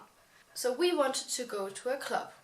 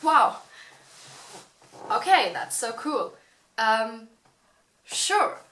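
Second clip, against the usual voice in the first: mock excited; 7 dB louder, slower than usual. Voices their own excited reply.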